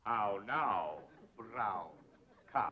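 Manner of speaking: "How now, brown cow" spoken softly with drawn-out vowels; diction drill. Shown as a demonstration. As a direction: space out "brown" and "cow", letting the voice fall. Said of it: "practice diction and intonation".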